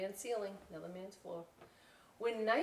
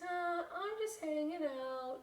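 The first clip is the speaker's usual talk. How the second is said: high, slow shy reply; lightly voiced shy girl; shy Ophelia; 9 semitones higher, slower than usual. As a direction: answer shyly.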